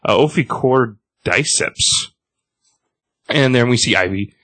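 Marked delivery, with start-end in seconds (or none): 0.2-2.1 Ophicordyceps said slowly, syllable by syllable; sounding out a big word. Shown as a demonstration. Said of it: "wow, that's a big word".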